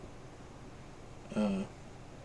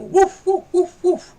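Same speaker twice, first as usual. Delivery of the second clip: high, loud woofs; imitated dog barking; 12 dB louder, slower than usual. Vlogger acting out his dog begging to go out, loud high barking. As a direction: bark sharply like an insistent dog. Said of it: "He's in here barking at me".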